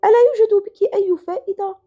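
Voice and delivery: high, reproachful mother's scolding voice; mother yelling. Retold or imitated. Mother scolding her troublesome daughter, shrill mock yell. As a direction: mimic the mother's exasperated scolding. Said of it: "تصرخ في وجهي".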